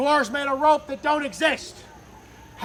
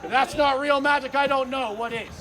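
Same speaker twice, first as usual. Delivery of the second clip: usual speaking voice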